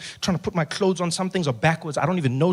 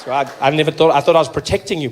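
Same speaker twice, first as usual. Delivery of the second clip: normal talking voice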